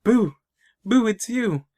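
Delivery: high, mock-delighted recognition; happy. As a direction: call out as if happily finding a lost friend. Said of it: "he's happy. He's like".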